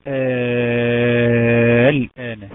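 الآن with the first «آ» held very long and loud; madd elongation. Shown as a demonstration. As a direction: chant الآن, sustaining the opening vowel. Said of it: "طيب مثال ايه؟". (مدّ طويل مرتّل في أول الآن)

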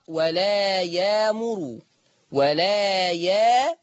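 ولا يامر slow, with damma on the ra. Shown as a demonstration. Teacher demonstrates Warsh reading with damma on ra. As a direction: draw out يامر ending in yamuru. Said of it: "هيقرأ بضم الراء هكذا".

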